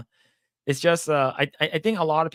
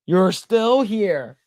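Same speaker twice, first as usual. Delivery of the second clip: slow drawn-out; 4 semitones higher, 6 dB louder, much slower than usual. Pretend. Storyteller drawing out the neighbor's line slowly, loud. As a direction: stretch "still" out slowly and loud.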